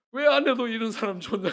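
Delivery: loud, aggrieved cry to God; shouted complaint. Retold or imitated. Husband crying out to God after a fight, loud and aggrieved. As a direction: hurl the question at heaven. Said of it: "이러면서 내가 막 하나님 앞에 막".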